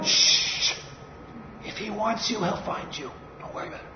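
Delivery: very soft, near-whispered shushing; hushed. Acted. Disciples quieting the blind beggar, hushed. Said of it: "What did the other disciples say".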